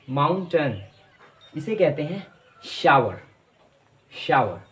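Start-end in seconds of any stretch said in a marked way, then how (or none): none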